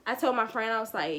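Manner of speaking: ordinary talk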